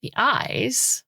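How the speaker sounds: high, squawking parrot voice; screeching macaw. Acted. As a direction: screech it like a talking bird. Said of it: "the macaw screeches".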